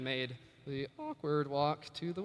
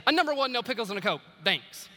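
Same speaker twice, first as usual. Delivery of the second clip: rushed order; 5 semitones higher, 5 dB louder than usual. Retold or imitated. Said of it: "shot back quickly".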